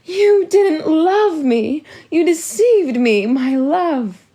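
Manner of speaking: high, loud, slow wail. A narrator's reading. Mum Olga wailing at her husband over being deceived, high and slow. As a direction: wail the betrayal out through tears. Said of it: "drowned out by Mum Olga's wails".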